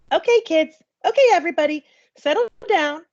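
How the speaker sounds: a teacher settling a noisy room; teacher calling class. A narrator's reading. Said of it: "said the teacher".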